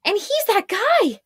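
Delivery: high squealing accusation; a shrill-pitched frightened point; squealed. Acted. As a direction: squeal it in alarm. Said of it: "squealed a woman".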